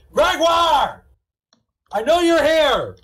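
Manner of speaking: loud high call across the room. Acted. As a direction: shout to someone hiding. Calling out to hidden character.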